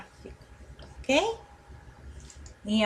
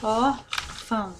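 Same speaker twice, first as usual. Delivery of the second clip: slow "ór... fão" with a gap between syllables; 4 dB quieter, much slower than usual. Shown as a demonstration. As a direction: split "Órfão" into two slow pieces. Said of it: "qual que é a mais forte?".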